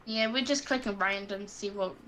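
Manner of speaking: normal talking voice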